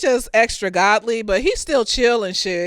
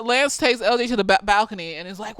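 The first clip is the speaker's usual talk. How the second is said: normal talking voice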